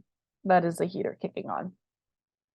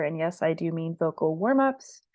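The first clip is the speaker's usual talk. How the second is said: usual speaking voice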